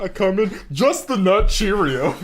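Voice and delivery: high, slow, laughing imitation; imitating him through laughter; laughing mock quote. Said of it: "That's literally what I walked back into".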